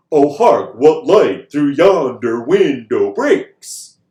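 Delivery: Oh, hark, what light through yonder window breaks, slow, loud, each beat thumped; iambic beat. Shown as a demonstration. Teacher to class demonstrating meter, slow thumped iambic stresses. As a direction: pound out the ba-bum rhythm of the line. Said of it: "An iamb is a ba-bum".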